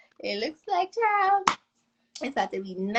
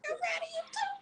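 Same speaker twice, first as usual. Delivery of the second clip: tearful proud of you; 11 dB quieter, much faster than usual. Real, not acted. Emotional tearful reply.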